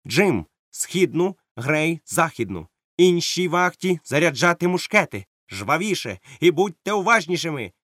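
high, brisk commands to his men; captain posting his men at the loopholes; captain's orders. Acted. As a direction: give battle orders crisply. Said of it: "він наказав".

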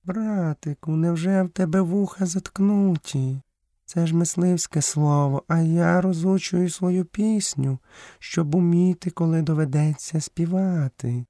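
high-pitched, measured panther voice; panther character, chiding. A narrator's reading. Panther chides little brother while rehearsing her song, high voice.

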